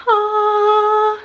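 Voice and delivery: long, high, sung-out "ahh"; elated "ahh". Retold or imitated. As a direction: imitate someone's blissful moment with a high, held "ahh". Acting stepdad's elated moment.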